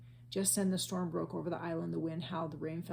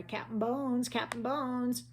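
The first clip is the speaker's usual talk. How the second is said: pirates' cry; 6 semitones higher, 4 dB louder, slower than usual. A narrator's reading. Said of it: "The pirates took up the cry".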